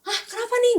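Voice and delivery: high, alarmed question, acted out; mock startled gasp of a question; retold startled reaction. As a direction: mimic a group caught by surprise. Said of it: "dia teriak sampai kita semua kayak".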